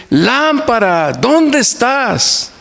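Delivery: put-on high, slow, drawn-out child's call with eyes shut. Grandfather imitating his grandson calling the lamp, high and slow. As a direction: call the lamp like a small boy, stretching each word. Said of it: "fuertemente decir".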